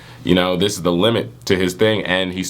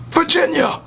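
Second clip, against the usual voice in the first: high squeal. Pretend. Yelled Vegeta pun.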